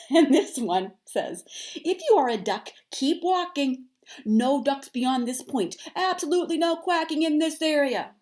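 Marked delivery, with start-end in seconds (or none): 1.7-8.1 sign read in Goose voice. A narrator's reading. Reading Goose's sign aloud.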